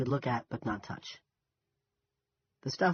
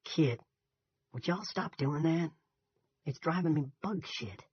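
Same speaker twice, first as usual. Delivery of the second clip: higher-pitched, slow Southern drawl; young soldier's slow Southern drawl; southern accent; 6 semitones higher, slower than usual. A narrator's reading. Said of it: "He had a southern accent".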